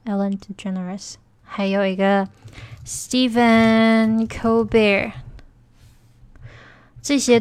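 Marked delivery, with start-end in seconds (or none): 0.1-1.2 Ellen DeGeneres repeated much softer, as an aside; quiet repeat. Shown as a demonstration. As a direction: murmur Ellen DeGeneres again to yourself. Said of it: "还有你们知道那个艾伦吗". (小声重复Ellen DeGeneres)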